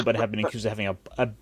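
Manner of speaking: ordinary talk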